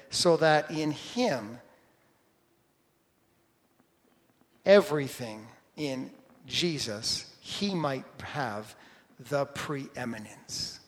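very slow, deliberate Scripture reading. Real, not acted. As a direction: read it out slowly with long pauses, letting it sink in. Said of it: "I'll read it slowly".

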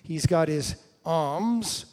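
normal talking voice

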